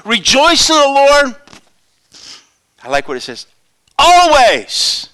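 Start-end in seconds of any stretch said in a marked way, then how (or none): 0.0-1.4 high, loud and slow; preached loud and high; proclaiming scripture. Real, not acted. Preaching scripture at full force. 4.0-5.1 loud, high single word; preacher's loud proclamation; proclaimed. Real, not acted. Preaching scripture.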